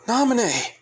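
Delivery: high, loud call of her name. Retold or imitated. Sora calling to Naminé in a high, loud call.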